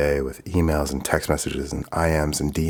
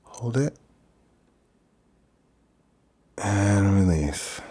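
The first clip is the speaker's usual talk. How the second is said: slow, drawn-out breathing cue; guided breathing; 3 semitones higher, slower than usual. Real, not acted. Guiding listener through a breath.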